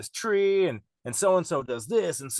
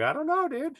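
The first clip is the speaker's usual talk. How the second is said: mock innocent; 13 semitones higher than usual. Shrugging off the friends' question.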